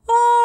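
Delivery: high, loud sighing ah like a yawn. Shown as a demonstration. Teacher shows a non-singing gesture, yawning sigh ah. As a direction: release it like a big yawn. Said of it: "kind of like a yawn or a sigh".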